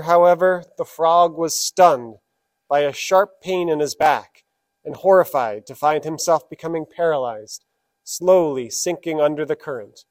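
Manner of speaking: ordinary talk, nothing marked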